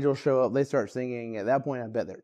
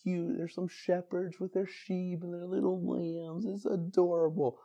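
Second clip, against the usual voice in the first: mock cute; 7 semitones higher, 5 dB quieter than usual. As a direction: coo it like it's all sweet and precious, mocking the idea. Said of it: "this story can sound nice".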